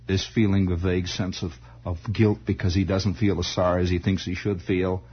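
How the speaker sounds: normal talking voice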